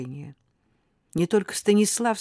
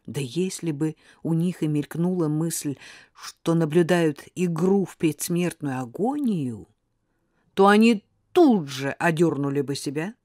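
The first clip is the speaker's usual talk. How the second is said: usual speaking voice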